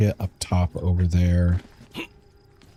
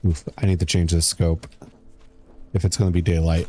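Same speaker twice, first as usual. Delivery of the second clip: ordinary delivery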